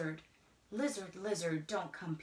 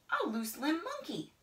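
plain reading voice

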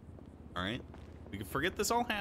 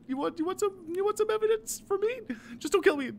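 high, nervous laughing plea; panicked squeaky pleading; 12 semitones higher, 4 dB louder than usual. Acted. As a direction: plead higher and higher. Rising panicked offer.

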